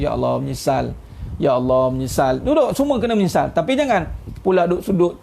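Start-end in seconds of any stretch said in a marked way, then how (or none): 0.0-2.4 low, sorrowful murmur to God; mock sorrowful regret. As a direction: act out showing your remorse. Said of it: "tunjuk sedih kat orang pula".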